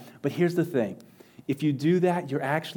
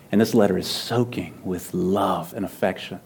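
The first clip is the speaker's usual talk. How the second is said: ordinary talk, nothing marked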